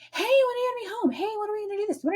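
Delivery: high, whiny pestering voice; high mocking imitation of a nagging caller. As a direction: pester like an annoying friend.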